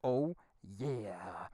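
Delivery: comically deep, hushed oh yeah. Acted. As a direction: drop to a very low, soft oh yeah. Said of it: "I can only say one thing".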